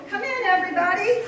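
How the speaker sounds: calling out. Acted. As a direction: call everyone in from the door. Mary calls the crowd inside.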